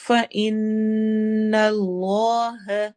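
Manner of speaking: فَإِنَّ with a held nasal نّ and a heavy lam in اللَّهَ; ghunna on noon. Shown as a demonstration. Teacher demonstrating ghunna, slow. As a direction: hum the نّ, then a heavy اللہ. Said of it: "نون پر نون غنہ ہوگا".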